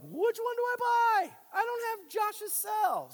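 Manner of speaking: high mock voice of a confused churchgoer in a bookstore; confused shopper. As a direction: squeak it out like a bewildered shopper. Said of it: "And you're like".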